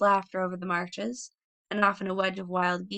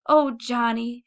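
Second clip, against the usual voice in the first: tearful cry; 4 semitones higher, 6 dB louder, slower than usual. Acted. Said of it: "she cried and buried her head".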